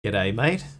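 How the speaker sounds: broad mock Australian greeting; matey Australian twang; Australian accent. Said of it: "the very Australian thing".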